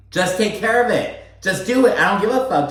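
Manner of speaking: high, exasperated dismissal; fed-up snap. Retold or imitated. Stanberry waving off husband's pestering, high exasperated snap. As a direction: wave him off, totally done. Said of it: "And she's like".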